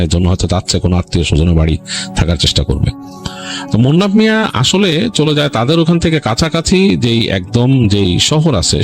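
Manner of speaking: ordinary delivery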